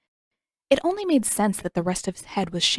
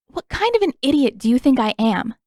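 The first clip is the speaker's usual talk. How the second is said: higher and louder, offended outrage; indignant anger; 5 semitones higher, 6 dB louder than usual. A narrator's reading. Katara outraged at the prince's question, indignant loud. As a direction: throw the insult back at him.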